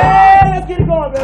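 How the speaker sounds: loud rallying cry; hype shout. Real, not acted. Leader fires up the marchers with a loud shout. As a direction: fire up the marchers.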